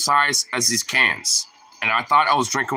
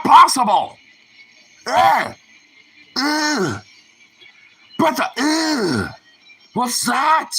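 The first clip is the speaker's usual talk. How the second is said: very high, loud strained groaning; strained disgust; 12 semitones higher, 4 dB louder, slower than usual. Real, not acted. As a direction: whine through the burning taste. Suffering from a sour mint.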